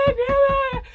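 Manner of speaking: jumping up and down urging; frantic urging. Retold or imitated. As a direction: cheer the puppy on, pitched high.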